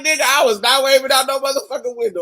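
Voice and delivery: loud, fast, high clapback; sassy retort. Retold or imitated. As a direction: snap it back fast and loud. Retorts his misreading, laughing.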